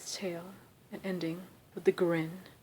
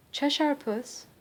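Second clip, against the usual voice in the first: hesitant, higher and a little unsure; Alice timidly addressing the cat; 7 semitones higher, 3 dB louder, slower than usual. A narrator's reading. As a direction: ask shyly, testing the name. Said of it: "rather timidly".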